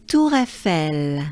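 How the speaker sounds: Tour Eiffel merged together, smooth; linked Tour Eiffel. Shown as a demonstration. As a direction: Tour Eiffel as one flowing phrase. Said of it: "it would sound like this".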